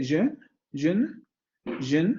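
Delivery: "je" with its schwa clearly sounded; teacher's isolated "je", rising at the end; vowel pronounced. Shown as a demonstration. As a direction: say "je" as a full syllable with its vowel. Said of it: "vamos a pronunciar esa vocal".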